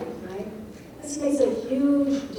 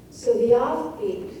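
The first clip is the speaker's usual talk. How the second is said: usual speaking voice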